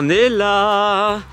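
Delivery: loud drawn-out call across the woods; calling names loudly. Real, not acted. Calling cousins in the forest.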